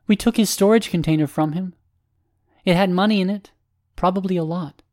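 furcate's character voice. Acted. Furcate reporting the stolen storage container, high character voice. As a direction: lift the pitch into a distinct character voice.

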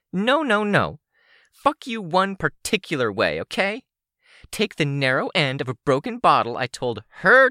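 high-pitched, accusing villainess; high, indignant character voice; angry villain woman. Acted. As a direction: hurl accusations in a high, hostile voice. Sidepiece keeps ranting at Rain.

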